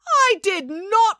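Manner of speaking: high, slow indignant denial; protesting. Acted. April denying she killed the cockroach, protesting. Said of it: "protested April".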